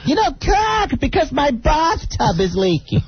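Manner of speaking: mother-in-law voice. Retold or imitated. Mimicking the mother-in-law's question in a high quavering voice. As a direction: play the mother-in-law asking, high and wobbly.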